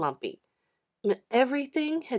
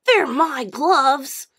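put-on slow, higher boy's voice; calm, drawn-out refusal; boy keeping his gloves; boy refusing; 6 semitones higher, 7 dB louder, much slower than usual. John refuses.

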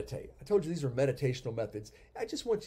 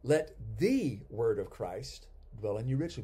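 "the" stretched out and punched as "thee"; stress on the; 4 semitones lower than usual. Shown as a demonstration. Teacher showing the second read-through, stress on "the". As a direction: lean hard on "the", saying it as "thee". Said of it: "be the second word emphasized".

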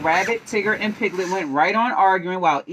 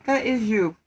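usual speaking voice

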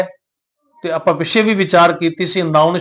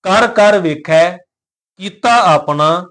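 slow recitation, pausing after ਵੇਖੈ and after ਆਪਣਾ; measured verse with clear breaks after ਵੇਖੈ and ਆਪਣਾ; marked recitation pauses; slower than usual. Shown as a demonstration. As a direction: stretch the words and rest on ਵੇਖੈ, then on ਆਪਣਾ. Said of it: "ਪਹਿਲਾ ਵਿਸ਼ਰਾਮ ਵੇਖਿਆ ਤੇ".

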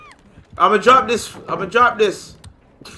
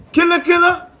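much higher, loud panicked yell; alarmed shout; 8 dB louder than usual. Real, not acted. Warning teammate the killer is near, panicked shout. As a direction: scream the warning.

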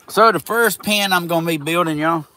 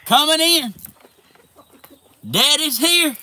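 greeting; 12 semitones higher, 5 dB louder than usual. Real, not acted. Greeting animals in pen.

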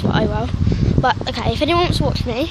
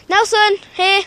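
raised voice calling a dog's name; 6 semitones higher, 4 dB louder, slower than usual. Real, not acted. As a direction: call the dog loudly across the park. Calling the dog in park.

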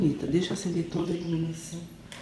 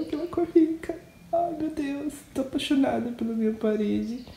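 gushing delight; 7 semitones higher than usual. Real, not acted. Gushing over the new wall colour.

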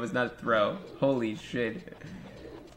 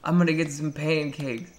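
put-on higher, excited kid voice over IHOP; mock excited kid; 3 semitones higher, 3 dB louder than usual. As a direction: gush like a little boy about pancakes. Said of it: "And I'm like".

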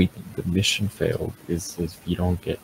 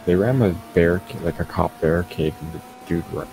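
ordinary talk

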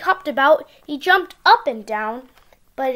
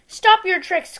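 calling out; 6 semitones higher, 6 dB louder than usual. Acted. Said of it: "called Sam".

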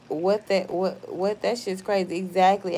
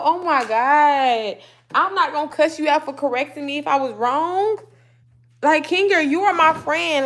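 exasperated protest; 5 semitones higher, 6 dB louder than usual. Real, not acted. Protests being called a cusser.

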